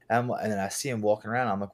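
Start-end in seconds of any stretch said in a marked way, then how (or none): none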